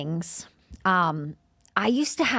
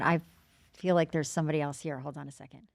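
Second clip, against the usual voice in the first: much softer, almost under the breath; quiet aside; 5 semitones lower, 7 dB quieter, faster than usual. Real, not acted. Medium interrupting her own story, quiet aside. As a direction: pull back and murmur it to yourself.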